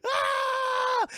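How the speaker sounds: high, loud, tearful shriek; hammed-up frantic hatred; hysterical screaming. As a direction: scream it, climbing to a wail. Voices Francesca screaming back.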